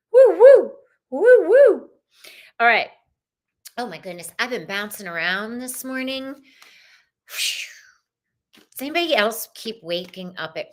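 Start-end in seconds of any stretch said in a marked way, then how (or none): 0.1-1.9 high, loud double whoops; celebratory whooping. Real, not acted. Host cheering her ladies on Friday, whooping. 6.2-7.7 long voiceless puff of air; blown-out sigh. Real, not acted. Host after bouncing around all morning, exhaling.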